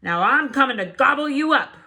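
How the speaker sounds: loud, high troll roar. Acted. Troll roaring at the big goat, loud. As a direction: roar your threat. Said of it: "roared the troll".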